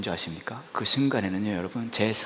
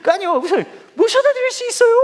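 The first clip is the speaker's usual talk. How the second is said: very high, loud insistent squeal; squealing staffer; 8 dB louder than usual. Retold or imitated. Staffer pressing the ride on the pastor, high squeal. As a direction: push the offer up into a squeal.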